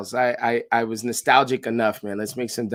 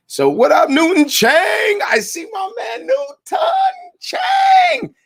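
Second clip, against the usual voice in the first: hyped shout-out; slower than usual. Real, not acted. Calling out to his friend Newton across the stream, hyped shout. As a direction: belt his name out like an arena announcer.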